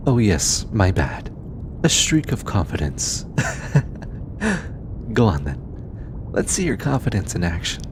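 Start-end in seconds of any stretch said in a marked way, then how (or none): 3.3-5.1 light, breathy laugh; teasing chuckle. Acted. Scientist teasing an eager bodyguard, amused chuckle. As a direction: chuckle at their sudden confidence.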